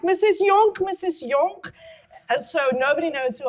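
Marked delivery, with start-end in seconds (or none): 0.0-1.7 high, loud calling of "Missus Yonk"; fans calling out. Retold or imitated. Shoppers spotting her mother in the mall, calling out high and loud. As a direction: call out to a famous woman across the mall. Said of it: "people going like".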